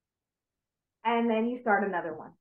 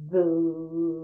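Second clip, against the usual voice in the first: slow low voo exhale; long breathy vu; 4 semitones lower than usual. Shown as a demonstration. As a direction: breathe out humming vu. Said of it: "I'll do it again".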